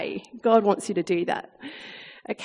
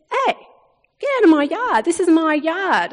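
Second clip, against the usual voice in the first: mock command; 6 semitones higher, 4 dB louder than usual. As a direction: stake your claim, hammed-up firm. Ordering intruder out of yard.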